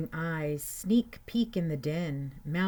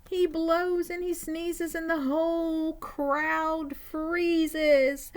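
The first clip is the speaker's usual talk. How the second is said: high, tense storybook climax; breathless suspense in a raised pitch; alarmed narration; 12 semitones higher, 4 dB louder, slower than usual. A narrator's reading. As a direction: tell it high and urgent, building louder. Alarm after the bear sneezes.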